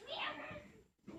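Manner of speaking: high mock meow from a child; cat meow. Child answering the teacher with a high meow. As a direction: mew like a kitten.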